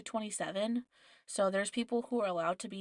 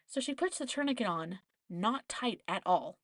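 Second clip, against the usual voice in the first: normal talking voice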